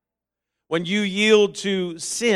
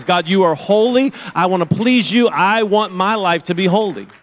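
loud, earnest declaration to God; fervent prayer; 7 dB louder than usual. Acted. Preacher voicing a believer's pledge to God, loud and fervent. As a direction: pray it out loud with full conviction.

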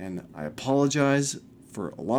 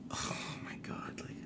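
whispered oh my God; 18 dB quieter than usual. Real, not acted. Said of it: "exasperated aside".

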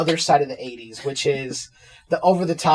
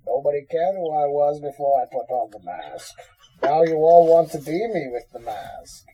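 menacing masked villain, muffled; villain speech through a mask; Bane voice. Pretend. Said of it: "Bane is here".

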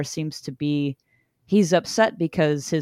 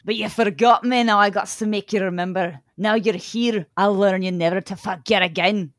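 lightly done Scottish Shrek voice; a hint of Shrek's Scottish brogue, reading the monster; mild Shrek impression. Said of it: "I basically did Shrek".

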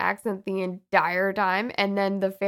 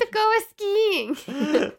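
high, slow, sing-song mock Swedish; mock Swedish accent; 13 semitones higher, 4 dB louder, much slower than usual. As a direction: ham up a Scandinavian skier, drawn out high. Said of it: "She should have been like".

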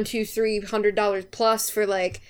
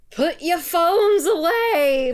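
slow, higher New York usher shout; New York accent; 8 semitones higher, 4 dB louder, much slower than usual. Retold or imitated. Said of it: "I love your New York accent".

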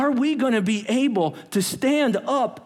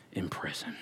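unvoiced falling whisper; 14 dB quieter than usual. Real, not acted. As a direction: let it fade to a whisper. Dramatic whisper ending.

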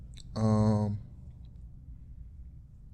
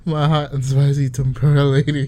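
mock romantic; 4 semitones higher, 10 dB louder than usual. Mock lover loud.

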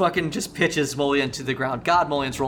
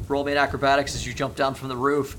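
ordinary talk

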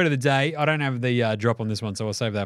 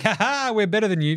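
high, taunting sing-out; hammed-up high-pitched taunt; mock gloating; 9 semitones higher than usual. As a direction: crow it like a cocky winner. Acts out team's gloating.

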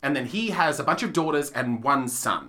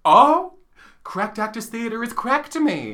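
mock earnest; 8 semitones higher, 5 dB louder, slower than usual. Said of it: "It's like crack".

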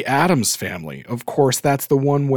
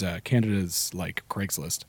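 normal talking voice

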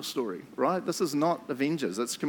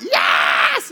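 frantic yes; 10 semitones lower, 8 dB louder than usual. Retold or imitated. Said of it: "I'm like".

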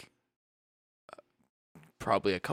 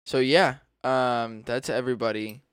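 ordinary talk, nothing marked

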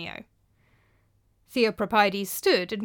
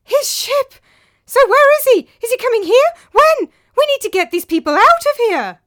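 panicked; 14 dB louder than usual. Acted. Panicked son at a party.

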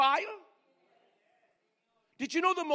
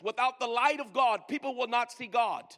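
normal talking voice

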